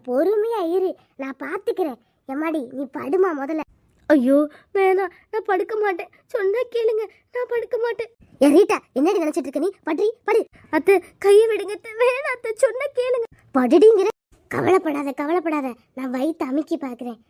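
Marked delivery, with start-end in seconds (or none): 4.1-13.3 high, rising frantic pleas to her aunt; frightened pleading. Acted. Refuses to lie down, pleading. 13.6-14.1 loud snapped command; angry order. Acted. Mother-in-law orders her to lie down.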